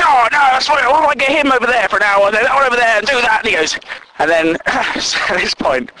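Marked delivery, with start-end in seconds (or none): 0.0-3.4 high, hurried meddling; mock a busybody overruling the plan; interfering know-it-all. Bossy colleague rearranging another man's work, meddling.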